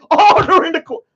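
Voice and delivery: hammed-up judge calling the court; mock court call.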